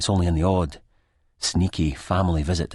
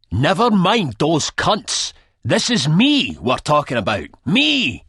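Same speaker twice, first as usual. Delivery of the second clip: much higher, much louder, very slow; savage snap; 11 semitones higher, 7 dB louder, slower than usual. A narrator's reading. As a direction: explode in self-pity and rage. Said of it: "snaps savagely back at me".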